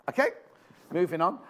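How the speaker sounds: ordinary talk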